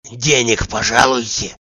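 ordinary delivery

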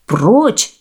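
ordinary delivery, nothing marked

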